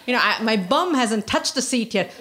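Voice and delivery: ordinary talk, nothing marked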